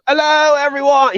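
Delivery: imitating a singer's put-on British accent, high and loud. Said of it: "he was doing this British".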